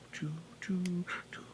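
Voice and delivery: soft whispered train noises; whispering. Acted. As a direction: whisper it under breath.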